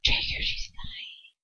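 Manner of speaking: slow high hushed whisper; soft whisper. Acted. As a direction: breathe it into his ear, slow. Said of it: "she whispers softly".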